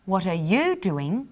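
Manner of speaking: "you" stressed with a rising end; a genuine, interested question; curious and interested. Shown as a demonstration. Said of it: "being curious and interested".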